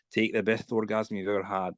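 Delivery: put-on film quote in a low Scottish accent. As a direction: quote it like a Scottish movie character. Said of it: "certain lines in an accent".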